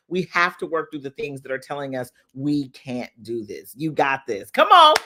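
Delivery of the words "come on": louder, rallying cheer; pep talk. Real, not acted. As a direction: pump them up like a coach. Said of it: "cheering viewers on".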